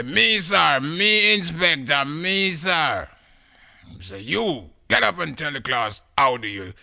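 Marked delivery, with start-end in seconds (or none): 0.0-3.1 high, loud pleading to be picked; eager pupil. Acted. Eager pupil begs to answer. 4.2-6.7 loud, high order to a pupil; inspector's command. Acted.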